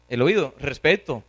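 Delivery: normal talking voice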